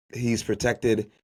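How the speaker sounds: usual speaking voice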